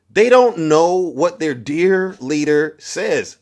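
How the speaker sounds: very slow, each word spelled out; slow mocking emphasis. Real, not acted. Streamer mocking MAGA ignorance, very slow. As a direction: lay it out slowly in disbelief.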